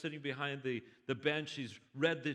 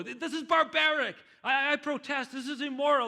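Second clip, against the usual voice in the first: high, loud furious protest; outraged shout; 12 semitones higher, 9 dB louder than usual. Acted. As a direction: hurl it at the bench. Richard keeps shouting in court.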